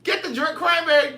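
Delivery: high, loud [name] bark; mock [name] snapping an order; [name] quote. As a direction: belt it like [name] telling someone off. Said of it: "That's what [name] said on".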